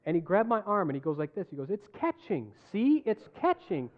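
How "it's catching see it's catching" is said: insistent doctor. Retold or imitated. Doctor demonstrates on painful arm.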